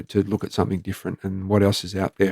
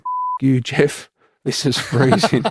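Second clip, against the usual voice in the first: mock yell at Jeff; 5 semitones higher, slower than usual. Soaked officer yelling at his dead mate, mock yell. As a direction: shout up at the sky, freezing and fed up. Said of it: "screaming out at Jeff".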